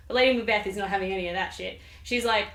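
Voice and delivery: usual speaking voice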